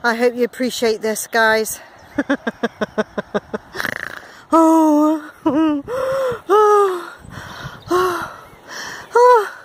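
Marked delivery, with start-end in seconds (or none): 2.2-4.2 low, soft staccato laugh; long chuckle. Real, not acted. Vlogger laughing at the effort for viewers, chuckling. 4.5-9.5 puffed moans between breaths; effort groans. Real, not acted. Climber struggling up the stages, groaning with effort.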